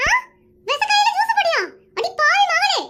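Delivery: high-pitched panicked accusation; comic high-pitched horror; horrified outcry. Acted. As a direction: wail in outrage at being poisoned. Learns the juice is poisoned.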